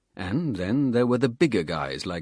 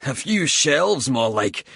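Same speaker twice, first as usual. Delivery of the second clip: snorting scorn; 5 semitones higher, 5 dB louder than usual. Acted. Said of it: "Bald snorted".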